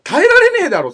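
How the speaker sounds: very high, loud exasperation; outraged shout. Real, not acted. Host tells partner he could never hold him up, exasperated shout. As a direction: explode at the absurd logic. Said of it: "一旦落ち着こう".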